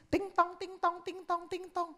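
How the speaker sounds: high sing-song ting tong; mock piano plinking. Child banging the piano off-key, sing-song. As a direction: plink out clumsy piano notes.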